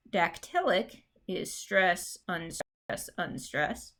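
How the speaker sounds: slow, strong then two weak; demonstrating the rhythm. Shown as a demonstration. Teacher modelling dactylic rhythm, slow and rhythmic. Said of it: "You do need to know these".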